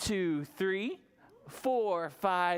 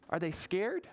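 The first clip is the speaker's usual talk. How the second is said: ordinary talk, nothing marked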